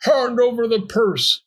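high, forceful robber's demand; chilling robber. A narrator's reading. Robber ordering Pearl to give up her purse, chilling. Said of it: "spoke in chilling voices".

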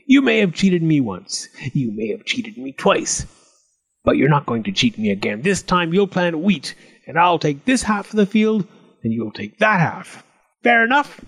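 put-on goblin voice, jumping high; animated goblin bargaining; scheming goblin, indignant. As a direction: lay down terms as an aggrieved goblin. Goblin lays down new terms.

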